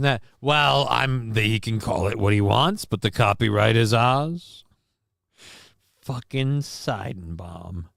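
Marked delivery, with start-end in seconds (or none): 6.0-7.9 muttered curse. Real, not acted.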